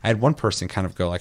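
ordinary talk

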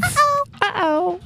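high squeaky uh-oh. Acted. Mock alarm at bringing back pause.

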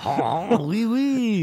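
high, hammed-up French "oui, oui"; mock French accent. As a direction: play the tourist in a French accent. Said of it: "Speaking in a French accent".

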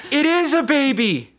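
high-pitched incredulous outburst; mock astonishment. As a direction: act out a stunned discovery. Acted shock at the baby.

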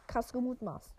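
ordinary delivery

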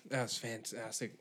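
normal talking voice

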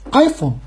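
iPhone in one quick falling English word. Shown as a demonstration. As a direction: say iPhone briskly, stress up front. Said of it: "我这说话太慢了，应该是".

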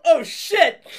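loud high startled yelp, laughing; startled curse. Retold or imitated. [name] turns to see the carriage go over, startled laughing shout. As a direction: blurt it in sudden alarm. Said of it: "I turn around and go".

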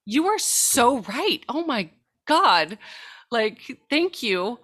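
high, loud, slow emphatic agreement; retold revelation. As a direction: act out a parent's sudden realization. Retold sudden realization to her child.

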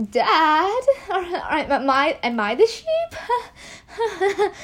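high, nervous laughing panic; hammed-up panicky whimper-laugh; panicking. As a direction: play a teen laughing in mounting dread. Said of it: "he was kinda panicking".

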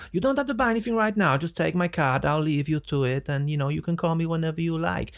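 high-pitched, smooth-talking German salesman; German accent. Retold or imitated. German salesman leaving his card with a customer, German accent. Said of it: "misrepresentation of the German accent".

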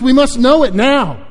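high, loud demand; impatient shout. Acted. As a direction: insist urgently at full voice. Fuge impatiently demands the answer.

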